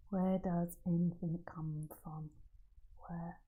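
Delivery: breathy hushed question, slowed; whisper. Real, not acted. Spiritual teacher whispers to the audience. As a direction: let the question fall to a whisper.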